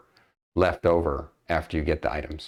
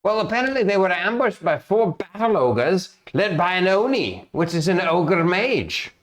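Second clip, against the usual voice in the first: worried guild master, lightly voiced, a bit higher; guild master NPC; 7 semitones higher than usual. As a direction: GM playing a fretful guild master breaking bad news. GM voices Mister Zolk.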